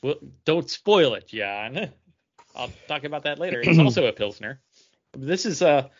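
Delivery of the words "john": ordinary talk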